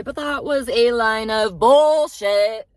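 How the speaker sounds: loud, high, drawn-out indignation; indignant outburst. Real, not acted. Driver calling out a passenger's breakup story, indignant outburst. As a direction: call it out, stretching the words loud.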